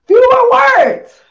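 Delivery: fewer words, slow and booming. Retold or imitated. Dad's terse words mimicked.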